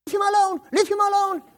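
high, loud mock-desperate yells; pretend screaming. Boy on the couch joining the fake beating, screaming. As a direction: scream it as if pleading, playing along. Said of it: "start screaming".

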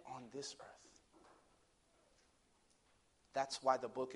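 low, soft sermon delivery; hushed preaching. Acted. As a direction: let each phrase settle quietly, with long pauses.

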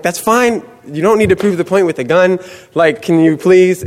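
high-pitched nervous reasoning; mock placating plea. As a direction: talk the gunman down, comically anxious. Acted placating the man with gun.

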